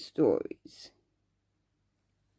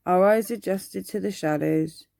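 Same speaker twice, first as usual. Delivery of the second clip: ordinary delivery, nothing marked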